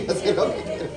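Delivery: a knowing little laugh through the words; amused father who has caught on; knowing chuckle. Retold or imitated. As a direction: laugh it off, you see right through him. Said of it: "Uno dice".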